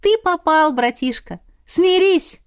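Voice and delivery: high, punchy taunt; triumphant sisterly teasing, pitch leaping; gleeful teasing. A narrator's reading. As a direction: crow over a cornered brother. Sisters gleefully tease their brother.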